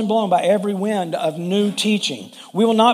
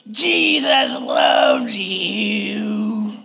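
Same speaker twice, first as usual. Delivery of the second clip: harsh, drawn-out mock shriek; imitated scream; much slower than usual. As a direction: mimic a hateful picketer, stretching every word very slowly. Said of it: "this lady that screamed this out".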